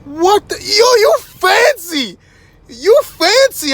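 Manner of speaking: high, slow, drawn-out gushing; hammed-up awe, stretching every word; amazed girl. Stranger marvels at his self-moving car, high slow gush. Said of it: "So she was like".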